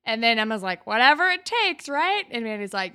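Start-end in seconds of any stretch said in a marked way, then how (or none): 0.9-2.2 high, loud and pushy; high excited quote voice; mock eager Emma. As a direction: egg her on with a hammed-up cheer.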